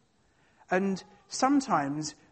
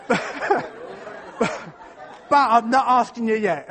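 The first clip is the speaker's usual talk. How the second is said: laughing through the words; loud, laughing punchline; 3 semitones higher, 5 dB louder, much slower than usual. Real, not acted. Laughing at proposal blunder.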